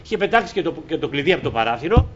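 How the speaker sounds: ordinary talk, nothing marked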